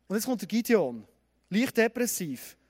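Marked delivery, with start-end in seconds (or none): none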